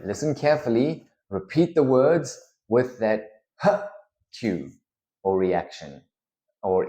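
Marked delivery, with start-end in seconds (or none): 3.6-3.8 short puff of breath; exhaled H. Shown as a demonstration. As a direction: exhale one quick H. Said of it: "exhaling initially".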